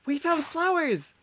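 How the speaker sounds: slow, high-pitched delight; delighted gasp. Real, not acted. Player discovers flowers, delighted high gasp.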